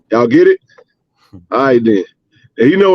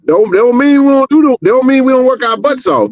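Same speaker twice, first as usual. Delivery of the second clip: excited; 10 semitones higher than usual. Real, not acted. Excited insistence on a point.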